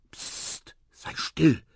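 urgent shushing, partly whispered; whispered hush. A narrator's reading. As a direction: hush a friend with a psst. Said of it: "flüsterte Quawa". (zischend zum Schweigen mahnen)

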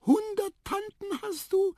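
very high squeak of surprise; astonished mouse. A narrator's reading. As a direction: squeak the question in wonder. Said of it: "fragte Tiputip verwundert". (verwundert piepsende Maus)

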